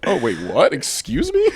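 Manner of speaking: loud, rising to a high laughing squeak; stunned surprise. Retold or imitated. Boy told the girl likes him too, loud high laughing surprise. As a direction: caught completely off guard, laughing. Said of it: "You're like".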